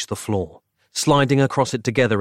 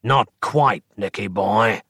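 cold hissing layered whisper; menacing rasp of a burnt doppelganger. Acted. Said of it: "said a rasping voice".

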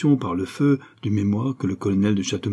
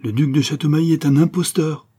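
higher, vehement accusation; raised pitch, outraged denunciation; sudden vehemence; 6 semitones higher, 4 dB louder than usual. A narrator's reading. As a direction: blurt out the accusation with force. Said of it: "avec une véhémence subite".

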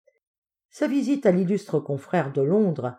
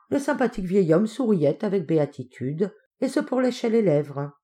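plain reading voice

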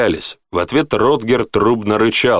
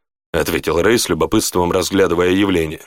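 usual speaking voice

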